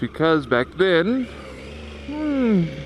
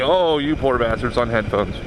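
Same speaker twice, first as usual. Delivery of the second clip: ordinary talk, nothing marked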